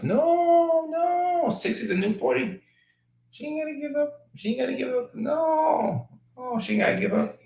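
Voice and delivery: very high, squeaky falsetto protest; mock protest. High-pitched mock protest at giving up.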